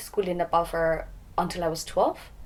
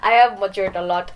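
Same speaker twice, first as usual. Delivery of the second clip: louder, talking through laughter; laughing; 4 semitones higher, 7 dB louder than usual. Real, not acted. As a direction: laugh while admitting it. Laughs admitting past stubbornness.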